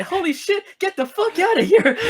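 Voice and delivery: high-pitched mock yell; squealed retelling of a frantic warning; Ryan. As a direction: imitate a panicked cry without full volume. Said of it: "I just remember Ryan's like".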